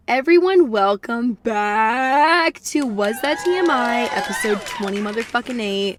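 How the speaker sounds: big, loud, slow podcast host welcome, pitched high; show intro announcer. Acted. Host opening the episode to listeners, loud announcer welcome.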